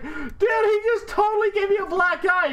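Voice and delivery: high, mock-outraged kid voice; imitated whining brother. Brother claims his sibling hit him, mock outrage. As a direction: cry foul in a hammed-up high voice.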